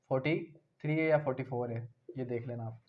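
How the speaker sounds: usual speaking voice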